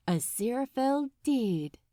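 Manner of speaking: ordinary delivery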